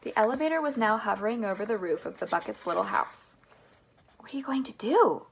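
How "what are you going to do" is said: higher, worried question; anxious cry. A narrator's reading. Charlie questions Wonka's plan, anxious cry. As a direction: ask it high, a touch alarmed. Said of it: "cried Charlie".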